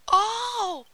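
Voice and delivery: high, loud delighted exclamation; merry. A narrator's reading. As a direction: exclaim merrily as a little boy. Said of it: "said Hansel merrily".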